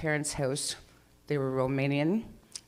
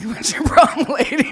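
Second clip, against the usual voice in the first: much higher and louder, laughing through a sassy cat voice; 9 semitones higher, 12 dB louder than usual. Retold or imitated. The cat refusing to fetch the ball again, high sassy voice. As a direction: give the cat an indignant, giggling diva voice. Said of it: "he looked at me and was like".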